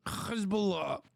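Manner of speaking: usual speaking voice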